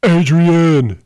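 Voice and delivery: high, loud drawn-out cry of a name; Sylvester Stallone. Acted. Player acting the boxer calling out after the match, hammed-up yell. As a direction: bellow it like the beaten movie boxer. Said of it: "Sylvester Stallone is who?".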